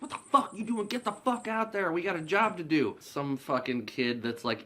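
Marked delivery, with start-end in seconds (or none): 0.0-3.0 high, sharp yell at a slacker; angry scolding. Retold or imitated. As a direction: chew him out, much higher. Marcus scolds Willie.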